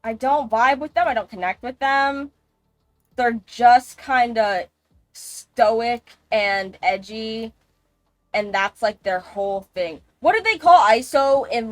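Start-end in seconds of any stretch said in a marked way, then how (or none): 3.1-7.5 very slow, dragging each word; deliberately slow, drawn-out delivery; drawn out slowly. Real, not acted. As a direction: stretch it out slowly for emphasis. Slowing down on the description.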